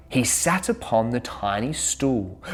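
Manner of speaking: ordinary delivery, nothing marked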